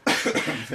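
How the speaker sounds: choking cough; sudden hacking cough; coughing up water. Real, not acted. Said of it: "Oh, [name], are you okay?".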